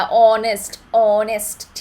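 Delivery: ऑनेस्ट with a silent h, opening on an o sound; silent h demo. Shown as a demonstration. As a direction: say ऑनेस्ट as "onest", vowel first. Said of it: "वो होता है ओ वाला".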